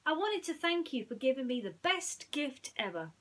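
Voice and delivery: a small mouse thanking his friend; Timothy, grateful. Acted.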